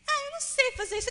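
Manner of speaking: high, fast whining complaint; frustrated child. Retold or imitated. Daughter complaining she can't play, high and whiny. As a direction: whine like a kid who can't do it.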